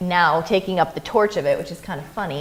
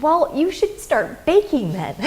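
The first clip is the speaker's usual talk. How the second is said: slow, much higher, gently encouraging; the filmmaker encouraging a little girl to bake, slow and kind; 9 semitones higher, 5 dB louder, slower than usual. Retold or imitated. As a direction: coax a child toward her dream. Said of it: "so I was like".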